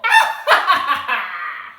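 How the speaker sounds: witch cackle. Acted. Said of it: "The Wicked Witch".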